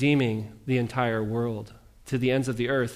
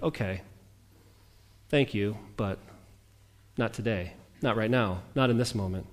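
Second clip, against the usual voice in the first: lower, softer polite refusal; grudging brush-off; 5 semitones lower, 6 dB quieter than usual. Retold or imitated. Preacher voices a reluctant listener, low and soft. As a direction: decline it quietly under your breath. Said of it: "you're like".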